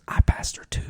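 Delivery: whispered, choked-up imitation; hushed retelling of a tearful confession; imitated weeping. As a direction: whisper it as if through tears. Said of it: "He's weeping".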